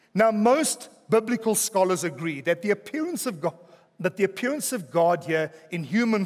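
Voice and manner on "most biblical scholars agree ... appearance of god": ordinary delivery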